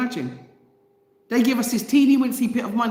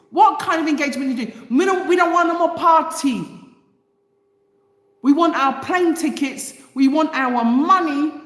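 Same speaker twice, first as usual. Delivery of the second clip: raised, insistent angry demand; angry, higher-voiced protest; real anger; 6 semitones higher, 4 dB louder than usual. Real, not acted. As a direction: pitch it up and demand it angrily. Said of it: "getting angry a lot this week".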